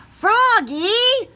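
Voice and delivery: high, loud exclamation; cried out. Acted. Teacher Miss Witherspoon calling out to Froggy, high loud cry. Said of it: "cried his teacher".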